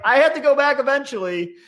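urgent pleading retelling; high, fast retold plea. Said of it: "I'm like".

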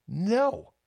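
squeaky high-pitched no; high emphatic no. Acted. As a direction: yelp the no way up high. Emphatic refusal.

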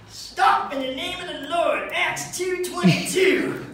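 high, loud, slow proclamation; hammed-up righteous holler, drawn out; mock grandmother's command. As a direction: shout scripture to halt an intruder. Grandmother commands the burglar to stop.

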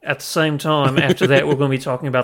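brisk put-on Aussie show intro; mock Australian accent. Co-host announcing the films as the guest, mock Australian accent. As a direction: rattle off the rundown in fake Australian. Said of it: "interested to hear my Australian".

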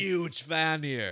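loud, drawn-out mock Australian; Australian accent attempt. Host reading out a fan's message on request, mock Australian accent. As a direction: stretch it big in a hammed-up Aussie accent. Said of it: "You gotta do the accent".

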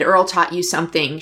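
ordinary talk

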